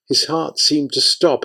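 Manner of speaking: plain reading voice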